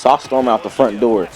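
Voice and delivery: ordinary delivery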